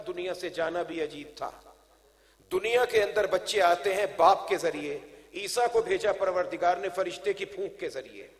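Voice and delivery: ordinary delivery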